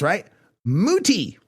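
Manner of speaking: ordinary talk, nothing marked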